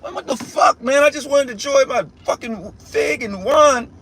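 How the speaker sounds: hammed-up annoyed complaint; a hint of exasperation at the interruption; annoyed Lord voiced.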